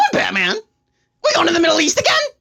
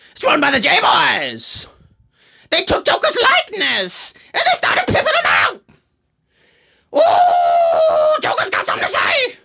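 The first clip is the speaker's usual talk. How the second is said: manic Joker; 4 semitones higher than usual. Acted. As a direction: wail and taunt Batman as a gleeful Joker.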